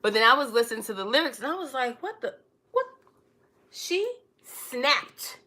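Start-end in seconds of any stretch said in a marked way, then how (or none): none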